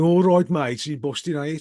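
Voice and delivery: hammed-up Birmingham accent; mock Birmingham accent. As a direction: imitate someone teasing with a broad Brummie accent. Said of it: "I'm originally from Birmingham".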